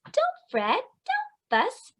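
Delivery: very high little mouse voice; high squeaky mouse comforting the bear. A narrator's reading. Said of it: "Mouse squeaks".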